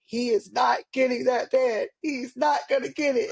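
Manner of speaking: hammed-up doctor digging in his heels; imitating the stern doctor. Mother imitating the doctor who refused her son's bed, mock shout. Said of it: "the doctor was like".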